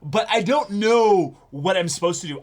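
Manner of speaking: rising stage fright as a character; flustered inner voice. Retold or imitated. Host voicing the anime character's stage fright, flustered. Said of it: "which is".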